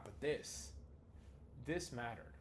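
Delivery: hushed and grave, with a pause; quiet, serious. Shown as a demonstration. Speaker showing students how quiet instructions matter, quiet and serious. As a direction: drop the volume so they lean in. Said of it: "when I'm quiet, serious".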